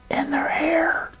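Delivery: high unvoiced whisper. Real, not acted. Whispering the answer to the child. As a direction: let the secret slip softly.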